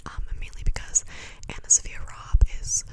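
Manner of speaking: normal talking voice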